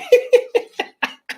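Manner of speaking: a real, high burst of laughter at the story. Real, not acted.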